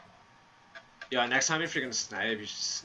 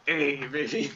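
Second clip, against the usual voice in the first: hype cheer; 4 semitones higher, 6 dB louder than usual. Real, not acted. Celebrating after round.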